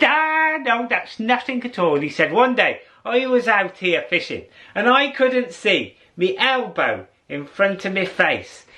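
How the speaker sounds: put-on high, lilting old fella telling a tall tale; old fisherman voice. Old fisherman boasting to the narrator about mist. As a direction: spin the yarn like an old Irish fisherman.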